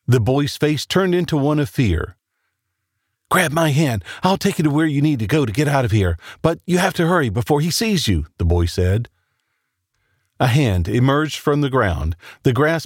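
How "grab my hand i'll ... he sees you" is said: urgent rush. Acted. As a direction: hurry her along, breathless urgency. Boy urges her to hurry.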